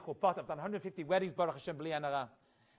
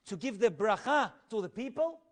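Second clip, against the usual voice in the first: ordinary delivery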